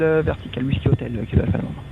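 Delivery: pilot over the radio. Real, not acted. Pilot finishing readback by radio.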